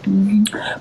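breathy murmured agreement; whispered mm-hmm. Real, not acted. To Cropper's chat, a whispered mm-hmm. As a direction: agree under your breath.

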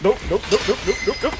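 panicked. Real, not acted. Monster closing in.

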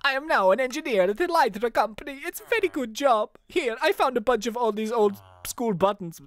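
put-on high-pitched Italian accent. Margie greeting a detective at the reunion, Italian accent. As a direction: play an Italian woman with a lifted voice. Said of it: "She could be Italian".

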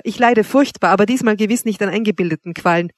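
ordinary delivery, nothing marked

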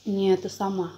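chewing while talking; eating dumplings at table; mouth full. Real, not acted. Seen 0: Dumpling eaten just before; chewing, wiping mouth during speech.

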